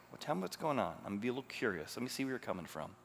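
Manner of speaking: coax, don't confront, softly; soft answer. Pretend.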